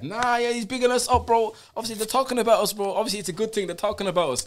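high, eager voice of a friend hyping it up; high-pitched delighted mate; excited friend. Retold or imitated. Friend's happy reaction retold.

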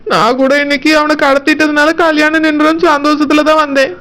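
ordinary talk, nothing marked

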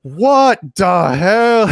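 hammed-up disbelief; mock outrage. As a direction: drag out an indignant what-the-hell. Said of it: "he's like".

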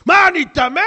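high, loud, indignant cry; offended outburst. Acted. Offended congregant snapping back at the rabbi, indignant shout. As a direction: throw it back at him, insulted.

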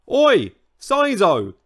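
loud, high, drawn-out call; roaring. A narrator's reading. Old Triceratops storms up to the newcomer, roaring. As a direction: roar it out angrily. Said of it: "he roared".

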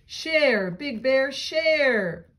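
tree calls. Acted. Reading a picture book, the oak tree calls Bear, high and loud. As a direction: call it out firmly to Bear. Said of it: "the old oak tree says".

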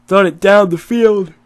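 words stretched by a yawn. Real, not acted. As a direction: talk through a yawn. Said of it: "Excuse me for yawning".

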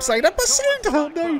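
very high-pitched yelp into dismay; frantic near-miss commentary; excited name call. Real, not acted. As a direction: shriek the name, then lament the miss. Chance and miss.

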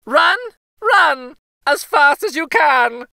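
high, loud, gleeful taunt; sing-song jeer at his chasers; gingerbread boy taunting shout. A narrator's reading. Runaway cookie shouting back at old couple, high gleeful taunt.

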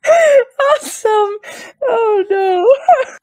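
high, loud laughter breaking through the words; laughing hard. Real, not acted. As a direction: squeal it through helpless laughter. Laughing fit.

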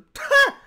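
a sudden high, loud whooping laugh; loud laugh burst. Real, not acted. Reactor cracking up at the diss, a loud high laugh.

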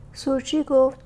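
ordinary delivery

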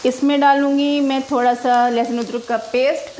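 normal talking voice

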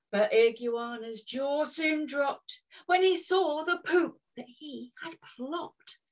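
ordinary delivery, nothing marked

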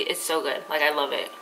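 speaking between sips of smoothie; words around a drinking straw; talking with straw at lips. Real, not acted.

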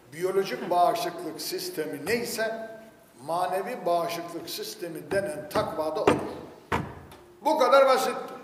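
ordinary talk, nothing marked

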